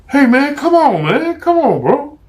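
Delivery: high and loud, begging; panicked pleading. Acted. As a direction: beg him to call it off.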